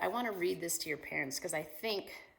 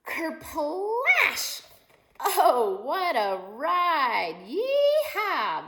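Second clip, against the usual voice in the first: high, loud whoops of joy; a storyteller shouting with glee at the splash; thrilled cheering; 10 semitones higher, 11 dB louder, much slower than usual. Acted. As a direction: cheer the ride like a rodeo.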